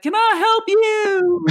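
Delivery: very high, drawn-out Southern drawl; older Southern gentleman. Retold or imitated. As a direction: imitate a Southern gentleman, stretching the last word high. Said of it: "older Southern gentleman saw us and was like".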